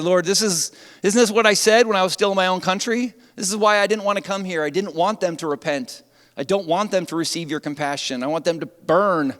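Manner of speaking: he is furious that God spared them; Jonah's angry complaint. Acted. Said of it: "and he became angry".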